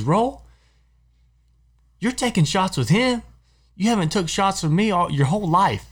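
a hint of a jealous drinking buddy; mock indignant buddy. Buddy's indignant retold complaint.

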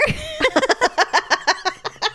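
a high burst of real laughter. Real, not acted. Laughing after the mock shout.

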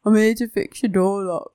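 slow, put-on man's voice. Locksmith tells householder his errand in a man voice. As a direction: play a bloke at the door, drawn out. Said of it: "do a full sentence with your man voice".

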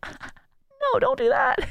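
high, teasing "no, don't do that"; mock disapproving friend. To listener imitating a doubter, mock high-pitched protest. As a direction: squeak out a mock warning to a friend. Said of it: "And you're gonna be like".

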